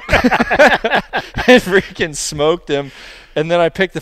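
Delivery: laughing. Real, not acted. Laughing while telling the shot.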